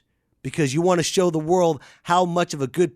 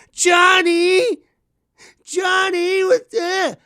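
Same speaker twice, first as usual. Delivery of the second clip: much higher, louder nagging parent calling Johnny; whiny parent call; 6 dB louder, much slower than usual. Retold or imitated. Mocking a useless parent calling out to Johnny. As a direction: call the kid's name in a helpless, high whine. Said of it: "I've seen parents".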